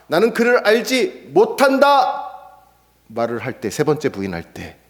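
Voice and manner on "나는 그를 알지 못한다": high, slow insistent denial; forceful denial. Acted. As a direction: deny it with full force. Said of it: "똑같이 이렇게 말합니다".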